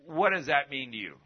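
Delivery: ordinary talk, nothing marked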